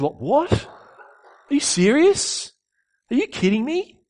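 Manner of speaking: high, slow, outraged as if he can't believe it; stunned furious disbelief. Retold or imitated. Elder son hearing of brother's return, furious high disbelief. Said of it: "He's furious".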